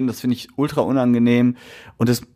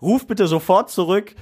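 answering machine message; 7 semitones higher than usual. Pretend. Leaving mock-angry voicemail for boss.

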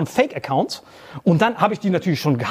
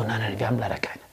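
mimicked whisper; 11 semitones lower, 9 dB quieter than usual. Employee refusing again, mimicked.

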